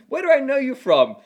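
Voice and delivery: high, loud mock-fan question; imitating curious strangers. Guest mimicking people who approach him, excited strangers.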